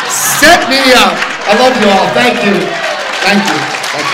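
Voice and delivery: loud, high call to the crowd; rousing sign-off. Real, not acted. Speaker closing to the audience, rousing loud sign-off. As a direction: shout your thanks over the applause. Calling out farewell over applause.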